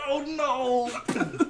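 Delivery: loud, laughing mock "oh no"; mock dismay. Host imagining Boba Fett falling to his death, laughing mock dismay. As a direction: ham up a helpless cry through laughter.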